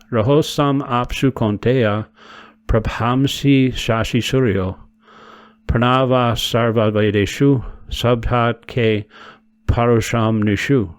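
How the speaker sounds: slow, even chant of the verse; slow devotional recitation; Sanskrit verse recitation. Acted. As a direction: intone each word slowly. Recites verse of text eight.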